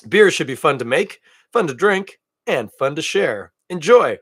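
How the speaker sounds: lively, ad-like reading of the can; peppy ad-copy delivery; upbeat label read. Acted. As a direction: sell the slogan like a beer ad.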